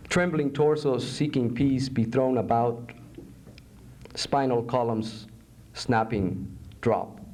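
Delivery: low, measured poetry reading; solemn poem narration. Acted. Poet reading a poem about torture, low and solemn. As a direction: intone the grim images evenly.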